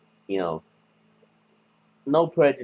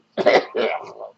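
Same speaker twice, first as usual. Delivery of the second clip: sudden coughing fit; choking cough on a bug; just swallowed a bug; 4 dB louder than usual. Real, not acted. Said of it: "Sorry, I swallowed a bug".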